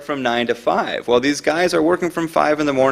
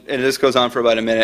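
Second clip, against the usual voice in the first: normal talking voice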